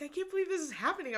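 fast, high mimicked amazement; excited disbelief. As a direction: imitate a friend's quick, high gushing. Friend's excited disbelief in dressing room.

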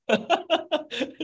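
a short self-mocking laugh; laughing at herself. Real, not acted. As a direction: laugh at being caught yelling. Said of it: "I'm laughing at myself".

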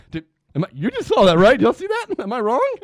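loud, high excited appeal to friends; hammed-up disbelief. Retelling Mark asking friends about the girl, hammed-up excitement.

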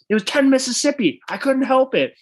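high, pleading self-defense; mock desperate excuse. Larry defending himself to Leon, high mock pleading. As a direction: imitate a man frantically excusing himself, voice way up.

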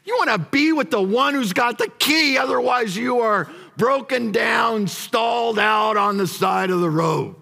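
full-force pulpit shout; preaching shout. Real, not acted. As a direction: shout it out to the congregation. Preacher building to a climax.

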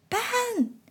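Nana calls out high and sharp across the yard; a sudden call from the doorstep; loud call. Acted. Grandmother calling the boy from the back door, loud. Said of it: "came a loud voice from behind".

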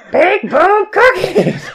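high, slow shouted slogan. Pretend. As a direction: belt it out like a cheesy ad.

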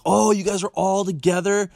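high, surprised question. Retold or imitated. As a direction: act out the surprise of hearing it. Retold phone call with friend.

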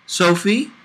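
put-on light high child's voice, questioning; lightly voiced boy calling to Sophie from the shower; little boy asking. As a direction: ask like a small curious kid. Little boy asks again.

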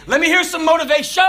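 rally the recruits like a staff sergeant; drill instructor. Retold or imitated. Drill instructor demanding motivation.

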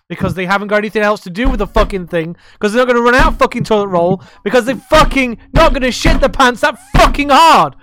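rising furious shout; angry rant. Real, not acted. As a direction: yell it out, getting angrier. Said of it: "I think yours needs a beer".

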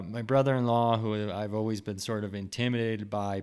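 ordinary talk

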